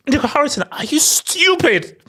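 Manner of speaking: mock scolding. Mock scolding of absent executive.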